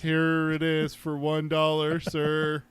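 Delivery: slow, level monotone, mock obedience; mock compliant servant. Co-host pretending brain implants control him, mock compliant monotone.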